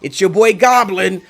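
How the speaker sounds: lightly voiced YouTuber catchphrase; imitating a youtuber intro. Quoting the YouTuber's intro to his friend, mock hype. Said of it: "that guy who's like".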